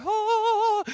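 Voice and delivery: high, held choir-like "ah"; one long high note of mock wonder; mock awed "ah". People watching Naaman walk on coals, mock awe. Crowd marvels at the healing.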